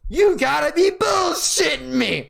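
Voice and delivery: high, slow, disbelieving yell; incredulous shout. Retold or imitated. Angry customer yelling at the gas clerk in disbelief. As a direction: bellow it in outraged disbelief. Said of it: "This motherfucker said".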